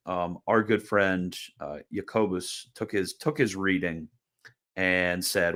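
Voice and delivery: ordinary talk, nothing marked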